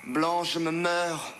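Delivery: rising from slow swoon to high cry; melodramatic lament. Acted. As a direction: overplay the dying lover, climbing high. Mock-dramatic death lament rising.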